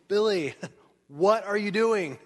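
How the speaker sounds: high, loud, chuckling call to Billy; casual God calling. Acted. Pastor voicing God calling Billy, high and laughing.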